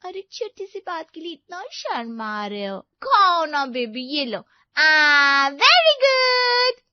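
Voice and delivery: slow, high, gushing feeding coo; coaxing girlfriend. Acted. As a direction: praise him like a toddler, stretched out. Voices Payal coaxing him to eat.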